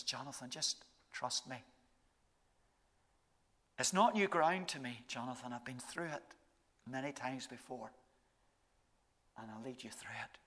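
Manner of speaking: soft, low, fading to a whisper; whispered reassurance. Retold or imitated. Jesus reassuring Jonathan about the week, hushed whisper. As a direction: speak it gently into his ear. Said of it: "what he whispers in our ear".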